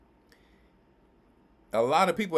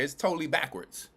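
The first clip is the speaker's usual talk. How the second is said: usual speaking voice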